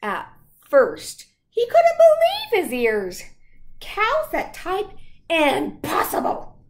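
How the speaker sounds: loud, lively storybook read; picture book read to children. Acted. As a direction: read aloud to the class with gusto. Reads picture book aloud.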